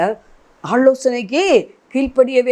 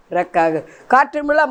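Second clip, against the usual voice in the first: ordinary talk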